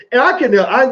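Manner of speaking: ordinary talk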